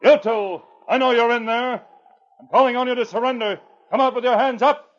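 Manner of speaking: loud, high call into the cave. Acted. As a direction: order them out of the mine. Calls into the mine.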